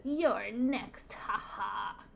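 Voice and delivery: cartoon villain threat with spoken laugh; Pumpkinhead. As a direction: taunt like a hammy monster. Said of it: "Pumpkinhead says".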